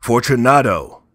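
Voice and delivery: loud high call into the dark; calling aloud. A narrator's reading. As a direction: call his name into the niche. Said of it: "I called again".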